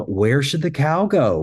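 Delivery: put-on high, slow, curious voice for a child; playful question. Shown as a demonstration. Trainer modeling a playful question for trainees, high and slow. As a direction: ask it like a game. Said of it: "We're starting to add questions".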